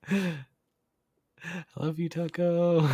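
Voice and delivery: high, slow and sweet; affectionate. Real, not acted. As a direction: gush it fondly. Affectionate reply to chat.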